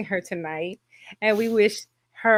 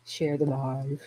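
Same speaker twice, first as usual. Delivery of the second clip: ordinary delivery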